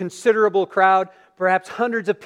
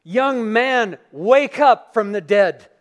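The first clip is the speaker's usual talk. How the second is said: louder commanding call, slowed on wake up from the dead; 4 semitones higher, 7 dB louder, slower than usual. Acted. Jesus commanding the dead son to rise, loud and slow. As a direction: order the dead man to rise. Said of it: "he gives a command".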